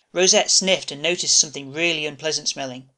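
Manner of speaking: ordinary delivery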